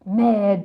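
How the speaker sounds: "Merde" said loud with a French "mèd", falling; loud French swear. Shown as a demonstration. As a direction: spit out "Merde" like a real French curse. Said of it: "It's shit".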